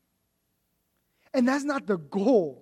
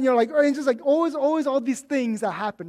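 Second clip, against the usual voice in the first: usual speaking voice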